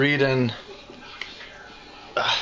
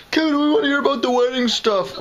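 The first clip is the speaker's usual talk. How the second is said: high mock fan voice; 13 semitones higher, 3 dB louder than usual. Voicing imagined audience.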